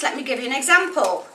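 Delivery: usual speaking voice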